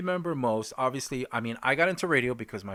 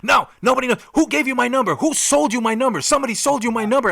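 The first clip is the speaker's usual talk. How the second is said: much higher and louder, near shouting, quickening at the end; retold frantic yelling; 10 semitones higher, 9 dB louder, faster than usual. Stranger on the phone accusing the surveyor, frantic high yelling. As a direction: yell like a paranoid man on the phone. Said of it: "the guy was freaking out".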